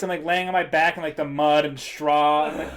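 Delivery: normal talking voice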